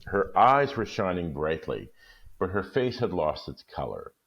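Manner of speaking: measured read-aloud storytelling pace; calm, even storyteller reading; story narration. Acted. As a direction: read it like a holiday story for listeners.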